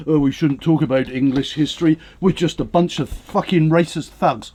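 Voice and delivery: a hint of a prissy historian, mocked; mocking historians. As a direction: sneer it out, lightly mimicking a pompous academic. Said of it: "these historians turn round and say".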